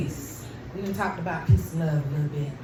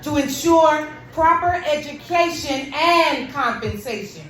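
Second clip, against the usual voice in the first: repeated higher and louder, each word stressed; emphatic repeat; 9 semitones higher, 6 dB louder than usual. Acted. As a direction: hit it again for the crowd.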